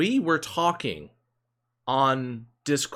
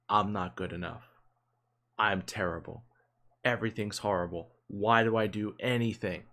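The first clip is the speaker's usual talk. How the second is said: mock self-pity; 4 semitones lower, 3 dB quieter than usual. Mocking friend's self-pitying thoughts.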